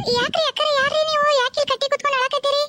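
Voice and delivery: worried stranger asking what is wrong; concerned young woman. Acted. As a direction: call gently to a crying woman. Ghost in human form greets woman.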